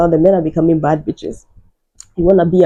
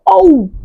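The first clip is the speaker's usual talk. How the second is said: hammed-up crying wail; 11 semitones higher, 5 dB louder than usual. Cry sound in story.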